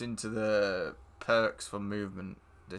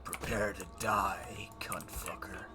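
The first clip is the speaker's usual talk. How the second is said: menacing whisper; 5 semitones lower, 11 dB quieter than usual. Acted. Gamer threatening the enemy army, menacing whisper. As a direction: breathe the threat like a villain.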